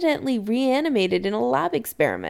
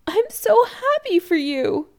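high-pitched, tearfully happy; teary; 12 semitones higher, 3 dB louder than usual. A narrator's reading. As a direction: well up with joy for your child. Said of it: "she sounds teary".

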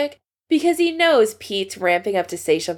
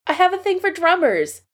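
prissy and a bit higher; prim; 10 semitones higher than usual. Acted. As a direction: say it primly, nose slightly up. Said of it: "says Patrick primly".

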